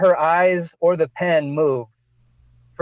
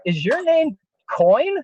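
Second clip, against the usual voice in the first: barista asking; 8 semitones higher, slower than usual. Retold or imitated. Barista asks.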